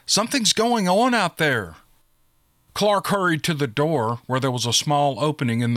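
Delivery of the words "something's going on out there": higher-pitched urgent warning; calling out. A narrator's reading. Valkyrie warning the boss about trouble outside, calling out. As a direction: call out a warning, mildly raised. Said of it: "called Valkyrie".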